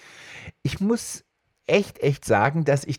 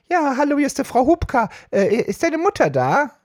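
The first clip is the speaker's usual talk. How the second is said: much higher, louder woman's voice; chatty neighbour lady; 4 dB louder than usual. Retold or imitated. Neighbour Frau Hubka calling for his mother, high woman's voice. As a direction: chirp like a gossiping neighbour.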